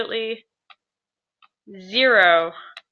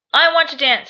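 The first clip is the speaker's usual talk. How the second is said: bright example read; 6 semitones higher, 6 dB louder than usual. Shown as a demonstration.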